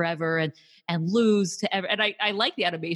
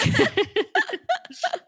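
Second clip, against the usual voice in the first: squealing laugh; a high helpless laugh; real laugh breaks out high; high burst of laughter; 3 dB louder than usual. Real, not acted.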